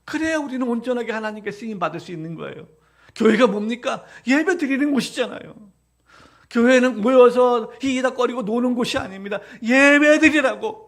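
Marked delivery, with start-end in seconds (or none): 3.1-10.8 loud, high, driving sermon voice, slowing on the hammered phrases; preaching at full force. Acted. Pastor rebukes the congregation for neglecting worship, preaching at full force. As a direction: thunder it from the pulpit, pressing each clause harder.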